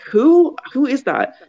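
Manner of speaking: high, loud incredulous question, imitated; baffled friend. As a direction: pitch leaps up, completely at a loss. Friend baffled by her freak-out.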